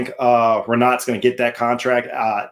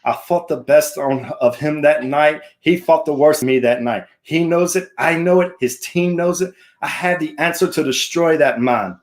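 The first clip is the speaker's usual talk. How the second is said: put-on higher-pitched amateur Irish accent; hammed-up Irish brogue, pitched up; Conor McGregor; 3 semitones higher than usual. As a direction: do your best Irish fighter impression. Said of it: "best I could do for an Irish impression".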